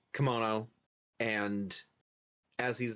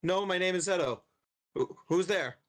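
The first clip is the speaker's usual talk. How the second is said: calling out; 5 semitones higher than usual. Acted. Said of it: "Edo calls out and goes".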